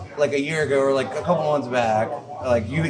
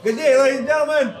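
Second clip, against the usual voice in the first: mock announcer; 11 semitones higher, 4 dB louder than usual. Mock host sign-off.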